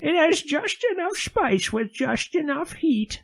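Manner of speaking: high, slow, hammed-up old lady. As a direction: read it like a sweet granny. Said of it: "that's my old lady voice".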